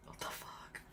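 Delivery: barely audible, voiceless imitation; whispered disbelief. Inmates reacting to the strip search order, whispered disbelief. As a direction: mouth it under the breath.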